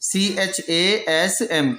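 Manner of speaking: सीएचएएसएम spelled out slowly letter by letter; spelling out letters. Shown as a demonstration. Teacher dictating the spelling to students, slow. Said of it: "काज़्म".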